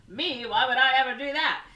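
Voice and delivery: high, loud mock-innocent leading man; Cary Grant. Suspect denies being the spy, indignant Cary Grant. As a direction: protest innocence like an old film star. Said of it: "That was a better Cary Grant impression".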